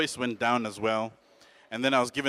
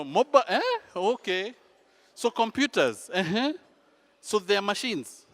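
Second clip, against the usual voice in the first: acted old man baffled by computers; puzzled grandfather; 8 semitones higher than usual. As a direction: work it out bit by bit, questioning. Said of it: "it was a very exhausting conversation".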